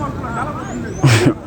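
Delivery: laugh. Real, not acted. Laughs at the question.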